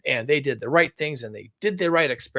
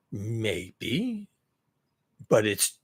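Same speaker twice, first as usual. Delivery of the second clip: mocking economist voice; 4 semitones lower, 4 dB quieter, slower than usual. Voicing complacent economists mockingly.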